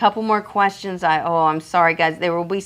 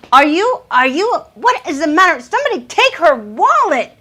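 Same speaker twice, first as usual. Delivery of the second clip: overwhelmed delight; 10 semitones higher than usual. Real, not acted. Host overwhelmed as a viewer sends a huge gift, shrieking delight. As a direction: shriek in thrilled disbelief.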